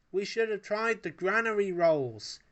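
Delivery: loud, high panicked cry. A narrator's reading. Wallace panics as the bike speeds toward the zoo. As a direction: blurt it out in a fluster. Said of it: "panicked Wallace".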